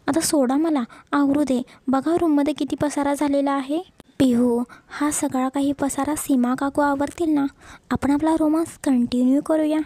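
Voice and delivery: ordinary delivery, nothing marked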